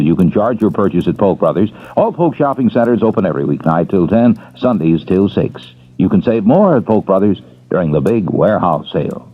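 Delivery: ordinary talk, nothing marked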